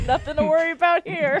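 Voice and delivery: fast, laughing ironic quip. Real, not acted. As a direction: laugh through the words. Laughing sarcastic remark.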